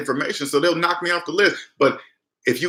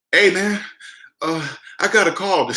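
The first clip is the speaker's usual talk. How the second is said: higher, hesitant, feigned nervousness; put-on scared voice; 5 semitones higher than usual. Caller ringing the scammers back, feigned fear. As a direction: act a little scared and unsure. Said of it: "This is how I sounded too".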